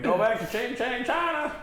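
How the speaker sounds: high, hammed-up character voice; Clayton Bigsby. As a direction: imitate a comic sketch character, pitched high. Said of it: "I also remember when the Clayton Bigsby".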